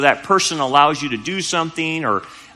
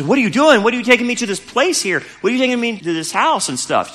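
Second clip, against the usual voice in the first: high, rushed panicky questions; retold freak-out; 9 semitones higher, faster than usual. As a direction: fire off questions, imitating a girl freaking out. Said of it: "She started freaking out".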